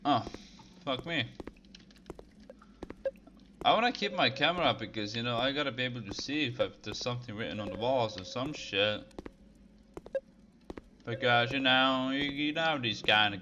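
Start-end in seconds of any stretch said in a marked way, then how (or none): none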